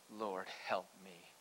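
low, soft, slow supplication; quiet plea. A narrator's reading. Woman worships Jesus and begs for help, quiet plea.

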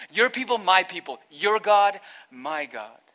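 each phrase punched loud, with a pause between; verbless, emphatic reading. Shown as a demonstration. Preacher reading the verse to the congregation, loud and emphatic. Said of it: "should read like this".

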